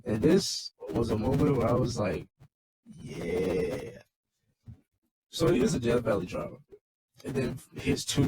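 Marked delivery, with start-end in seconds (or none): 2.9-4.0 low, long-held yeah; retold savoring reaction; drawn-out yeah. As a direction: stretch out the word, low.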